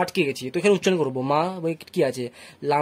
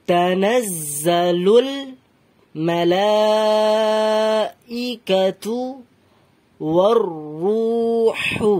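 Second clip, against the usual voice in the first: very slow Quran recitation, full voice; unhurried, sustained demonstration for learners; slow, raised-voice recitation; 3 semitones higher, much slower than usual. Shown as a demonstration. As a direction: stretch every long vowel and project. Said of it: "ধীরে ধীরে পড়বেন, but উঁচু গলায়".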